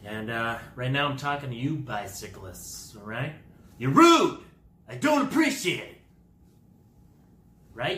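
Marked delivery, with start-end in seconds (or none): 3.8-6.0 loud, high-pitched telling-off; comic outraged yell; indignant scolding. Acted. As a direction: shout it high at the offenders. Telling off bicyclists.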